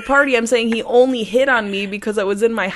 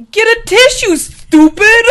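playful yell; 12 semitones higher, 12 dB louder than usual. Real, not acted. Yelling at friend playfully.

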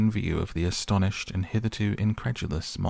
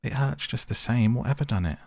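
higher, pained grumble; acted hurt, voice pitched up; 6 semitones higher, 3 dB louder than usual. A narrator's reading. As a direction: complain through a throbbing hurt. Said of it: "forgetting his grammar in his pain".

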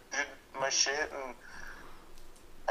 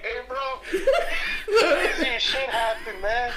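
laughing; 8 semitones higher, 5 dB louder, slower than usual. Retold or imitated. Retelling the joke to his friend.

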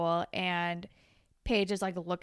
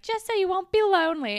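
high, loud cutesy quote; mock sweetness; 10 semitones higher, 6 dB louder than usual. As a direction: imitate a coy consolation, pitched way up. Voicing Emma kissing Rick.

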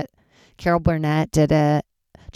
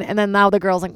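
ordinary talk, nothing marked

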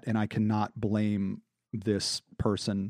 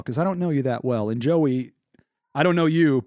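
ordinary talk, nothing marked

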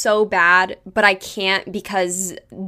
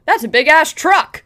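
imitating the biker; 5 semitones higher, 5 dB louder than usual. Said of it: "you can just hear him go".

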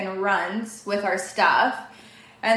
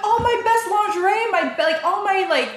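much higher, louder laughing wail; indignant protest; 10 semitones higher, 4 dB louder than usual. Retold or imitated. Wife retells protesting to her husband about her suitcase, high laughing wail. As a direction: wail over the lost lingerie half-laughing.

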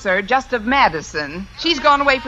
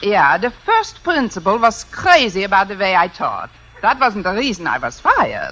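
mock-German teacher's phone voice; put-on German accent; 3 semitones lower than usual. Fake applicant explaining her firing, put-on German accent. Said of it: "If I can do a decent German accent".

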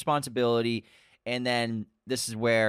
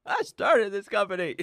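mock villain; 8 semitones higher than usual. Repeating Norman's line.

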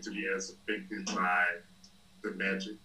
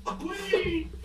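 dramatic queen speech; 7 dB louder, slower than usual. Retold or imitated. Quoting a show's queen speech.

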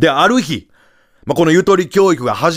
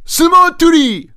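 grand announcing; 12 semitones higher, 3 dB louder, much slower than usual. Retold or imitated. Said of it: "そして三つ目".

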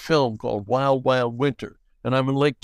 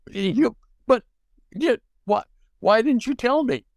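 much higher pitched, stunned questions; disbelief; 10 semitones higher than usual. Retold or imitated. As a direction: caught off guard and baffled. Shocked at Buck's reveal.

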